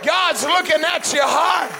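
high, loud exhortation from the pulpit; full-force preaching. Real, not acted. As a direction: plead with the congregation at a shout.

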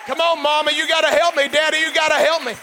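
loud, high preacher's shout; full-force preaching. Acted. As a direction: preach it out to the whole congregation.

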